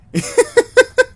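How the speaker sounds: very high, loud burst of laughter. Real, not acted. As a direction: shriek with laughter. Laughter at the pistol.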